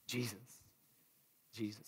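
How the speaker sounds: soft awed murmur; hushed reverence. Acted. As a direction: breathe the name in awe. Reverent answer.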